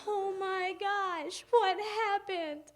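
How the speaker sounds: loud, high, drawn-out dismay; mock panic. Girl wondering what her sister did, mock-panicked wail. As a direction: wail it slowly in hammed-up alarm. Retold alarm at classmate's terror.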